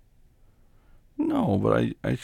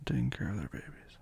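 whispering; 5 semitones lower, 6 dB quieter than usual. Real, not acted. Comforting sick girlfriend.

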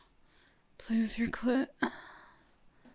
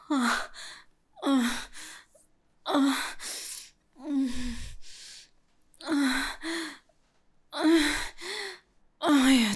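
moans climbing higher and louder each time; escalating moans; 5 semitones higher, 8 dB louder, slower than usual. Acted. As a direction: build the moans toward a peak.

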